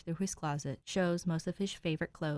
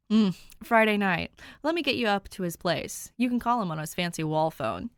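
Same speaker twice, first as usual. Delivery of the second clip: big man voice; 2 semitones higher, 7 dB louder than usual. A narrator's reading. Dave voiced.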